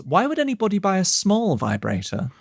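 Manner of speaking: lightly mimicked mum; imitating mum. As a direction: play the baffled mother. Mum quoted in story.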